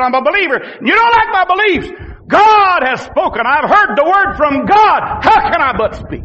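fervent preacher at full tilt; impassioned prophet's retort. Acted. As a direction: fire back at the complainers. Acted prophet answering his critics.

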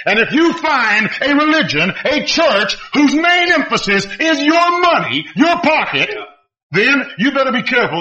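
high, insistent pulpit delivery, stretched out; impassioned preaching. Real, not acted. Preacher warning the congregation about money, forceful preaching. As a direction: preach it high, leaning on each phrase.

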